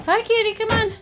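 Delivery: high-pitched cooing call to a cat; real talking-to-pets squeal. Real, not acted. Calling the cat over.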